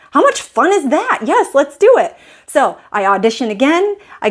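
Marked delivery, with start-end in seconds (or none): 0.1-2.2 excited. Retold or imitated. Eager quote of herself.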